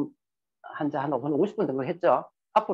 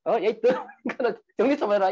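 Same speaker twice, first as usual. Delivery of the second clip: higher-pitched mock scolding of a messy husband; imitating an angry wife; 6 semitones higher than usual. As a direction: snap at him like a fed-up wife. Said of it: "순간적으로 화가 확 나가지고". (지저분한 남편을 나무라는 아내의 흉내, 높은 음조로 화난 듯이)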